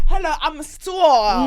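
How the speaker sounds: high, loud, drawn-out diva greeting; star introducing herself. Acted. Host teasing guest about her one-name brand, grand star voice. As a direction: announce yourself like a big star. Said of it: "it's given like".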